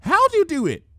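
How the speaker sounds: high, loud eager question; thrilled high-pitched asking; excited. Acted. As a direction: bounce with excitement as you ask. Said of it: "in excitement".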